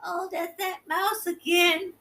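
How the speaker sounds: ordinary talk, nothing marked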